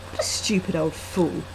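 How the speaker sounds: ordinary delivery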